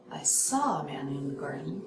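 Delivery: SAW leaned on, the rest dropping low; contrastive focus. Shown as a demonstration. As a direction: lean on SAW, then let garden stay low. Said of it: "Who did you see in the garden?".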